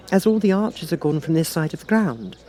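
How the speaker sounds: put-on a young girl reasoning to herself; Alice voice. Alice reasons to herself, girlish.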